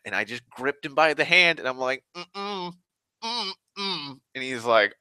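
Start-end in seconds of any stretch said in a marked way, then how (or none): 2.2-4.2 high, soft closed-mouth "mm-mm"; wordless pained refusal. Retold or imitated. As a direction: hum a tight refusal through pain, pitched high. Hurt, refuses to be touched.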